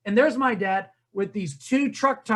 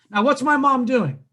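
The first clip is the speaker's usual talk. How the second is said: ordinary talk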